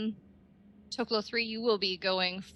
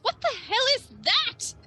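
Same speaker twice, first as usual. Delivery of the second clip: startled bandit; faster than usual. Acted. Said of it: "one of them goes".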